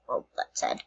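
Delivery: whispered sadly to herself. Real, not acted. Whispering to viewers while sending the gift back. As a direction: breathe it out in a whisper.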